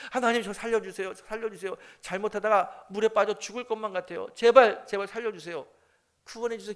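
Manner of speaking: acted desperate prayer. Trapped churchgoer praying to God as floodwater rises, acted urgent plea. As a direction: act out begging for rescue. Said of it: "손을 모으고 하나님께 기도하는 거예요".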